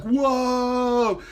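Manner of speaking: very high, loud whoa; joking about dreamed-of instant results, high and loud; mock amazement. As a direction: gasp at imaginary results.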